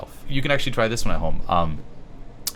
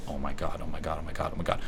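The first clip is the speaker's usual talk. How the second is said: mock panic; 2 semitones lower, 6 dB quieter, faster than usual.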